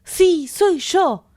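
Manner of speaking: mucho más agudo, fuerte y lento; grito alegre. A narrator's reading. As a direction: lo proclama eufórico. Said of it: "volvió a gritar el joven". (Colin grita a sus amigos, eufórico)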